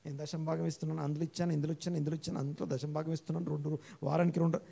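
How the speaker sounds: softly muttered boasting prayer, said under the breath; inner prayer. Retold or imitated. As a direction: mimic a smug prayer kept quiet inside. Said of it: "లోపల లోపల ప్రార్థన ఇది". (లోపల లోపల గొణుక్కునే గర్వపు ప్రార్థన)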